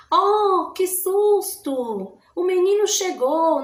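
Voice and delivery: high, loud gasp of surprise, drawn out slowly; surprised exclamation. Shown as a demonstration. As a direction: act out a startled "Oh" for the class. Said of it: "Ele serve para indicar uma admiração, uma surpresa, um susto". (exclamação de susto, alta e arrastada)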